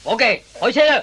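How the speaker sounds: loud, high call to drive off; shouted order. Acted. Policeman orders driver to go, loud and high. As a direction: bark the order to start the car.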